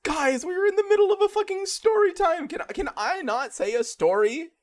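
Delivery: high, loud exasperated yelling to the viewers. Real, not acted. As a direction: yell in real frustration, calming toward the end. Complaining to viewers after ambush.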